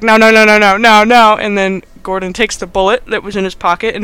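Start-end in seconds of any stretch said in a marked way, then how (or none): 0.0-1.4 loud, mock-panicked protest; retold frantic refusal, voice up in volume; frantic pleading. Adam sees the gun taken, mock frantic protest. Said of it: "then Adam's like".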